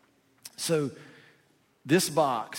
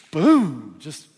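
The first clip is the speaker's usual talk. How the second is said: mock triumphant boom; 10 dB louder than usual. Mimics a one-up story.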